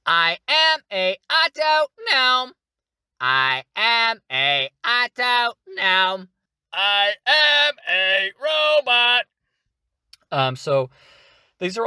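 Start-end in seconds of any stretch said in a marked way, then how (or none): 0.1-9.3 high, slow, sing-song robot. Shown as a demonstration. Voice tutor demoing a small robot, sing-song robotic. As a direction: chant each word on its own note like a little machine. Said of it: "do a couple variants of them right here".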